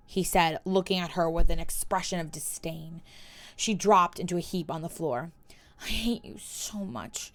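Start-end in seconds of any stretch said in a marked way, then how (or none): 5.8-7.3 quiet despair. Acted. Collapsed on the floor.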